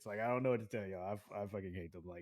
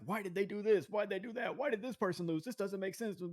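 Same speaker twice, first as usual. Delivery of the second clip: high, whiny string of complaints; mock fan complaining; 8 semitones higher than usual. Wrestling fan griping about results, mock complaining. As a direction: pile up the questions like a nagging fan. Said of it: "it was just so annoying".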